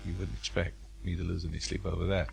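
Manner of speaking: usual speaking voice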